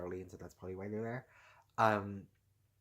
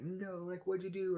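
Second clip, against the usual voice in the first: high, dismayed mock cry; 10 semitones higher than usual. Gamer whose TV got unplugged, high dismayed mock cry. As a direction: squeal at the unplugged game. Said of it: "it's like".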